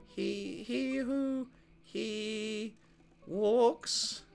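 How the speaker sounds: high faltering twin voice; creepy twins. Acted. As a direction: stumble through the spooky line. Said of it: "creepy twin shtick".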